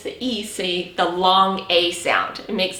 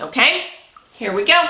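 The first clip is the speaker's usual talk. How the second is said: ordinary delivery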